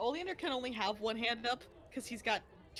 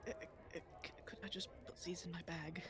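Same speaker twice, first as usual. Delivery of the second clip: stammering, hushed, low request; timid near-whisper; 5 semitones lower, 11 dB quieter than usual. Acted. Oleander asking the guards about his books, timid whisper. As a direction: ask meekly, barely voiced.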